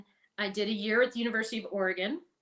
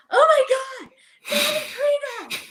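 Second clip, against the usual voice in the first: high, loud mock squeal of recognition; excited shriek; 10 dB louder than usual. Woman in audience recognizing Debbie, high excited shriek. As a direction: shriek her name across the audience. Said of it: "this woman stands up and goes".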